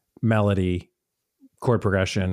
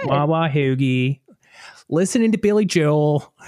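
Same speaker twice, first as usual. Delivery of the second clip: put-on stretched-out, higher Pittsburgh accent; improvised Pittsburgh voice; 4 semitones higher than usual. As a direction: have a shaky-confidence go at a Pittsburgh accent. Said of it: "can't really say [name] in Pittsburgh".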